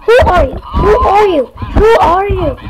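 loud, demanding yell; real loud who-are-you yell; shouting. Real, not acted. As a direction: shout it at the strangers. Shouting at strangers in game.

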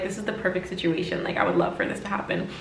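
hushed, breathy wishing to herself; soft whispered longing; dreamy whisper. Retold or imitated. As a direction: whisper it like a private daydream. Dreamy imagining of ideal bus seat.

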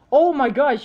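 high, loud burst of disbelief; real excited outburst; loud exclamation. Real, not acted. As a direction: exclaim at the game in amazement. Reacting to play on screen.

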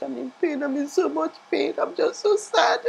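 retold crying to her son; tearful complaint. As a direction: whimper it like you're about to sob. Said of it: "I'm like".

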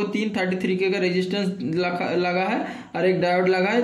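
ordinary talk

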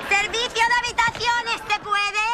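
high, drawn-out announcement; calling at the door. Acted. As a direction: sing it out slowly to whoever's inside. Maid announces herself at door.

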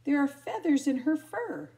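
high, loud exclamation; exclaiming. A narrator's reading. Exclaimed line in the story.

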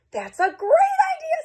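very high, squealing delight; thrilled Nebraska. Acted. Nebraska loving Kansas's party plan, squealing high. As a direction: squeal with joy at the idea. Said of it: "said Nebraska".

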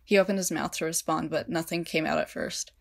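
ordinary delivery, nothing marked

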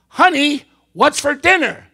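high, loud acted call. Husband testing wife's hearing from behind, loud high call. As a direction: call to someone across the room. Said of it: "He gets right behind her".

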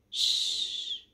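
long voiceless shush; sustained "shh" to settle someone. A narrator's reading. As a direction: draw out a hushing hiss. A hushing voice before words.

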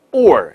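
or with the tongue curled into r. Shown as a demonstration. Teacher contrasts it with all, clear curled r. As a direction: end or on a held curled r. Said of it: "再加卷舌".

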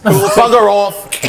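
put-on New Zealand accent. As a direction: drawl it like a grumpy Kiwi. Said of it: "the New Zealand accent".

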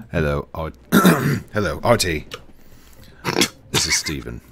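lightly put-on British-Australian help desk voice; put-on British-Australian accent. Shown as a demonstration. Showing a friend the work phone act, put-on British-Australian accent. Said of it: "I got that one down pretty good".

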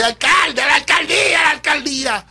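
imitated street shouting; crowd shouting. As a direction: cheer like people in the street. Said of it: "todo el mundo me grita".

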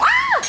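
sudden high yelp into a laugh; startled squeal breaking into laughter; high laughing shriek; surprised laughing shriek. Real, not acted. Friend surprises her, laughs.

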